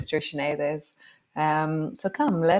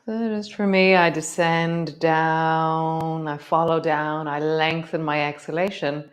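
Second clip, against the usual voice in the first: guided meditation. Acted. Yoga teacher guiding the class into breath, calm meditation voice. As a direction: settle them into the breath.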